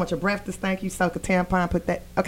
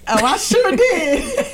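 laughing; 8 semitones higher, 3 dB louder, slower than usual. Real, not acted. Proud teasing reply.

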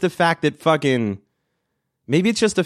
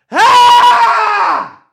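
long, loud, high scream; venting scream; 10 dB louder than usual. Shown as a demonstration. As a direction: scream out your frustration in one breath. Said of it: "Are you guys screaming".

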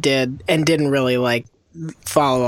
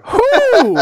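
very high, loud, drawn-out hoot; loud exaggerated high-pitched cry; mock outcry; 6 dB louder than usual. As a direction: whoop it even in comic disbelief. Repeating the outburst at a name.

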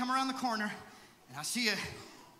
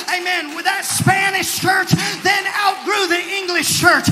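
loud preacher's cry, climbing higher; full-force preaching; 7 semitones higher, 14 dB louder than usual. Real, not acted. Preacher asks the church what God could do, rousing shout. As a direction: build the questions to a shouted peak.

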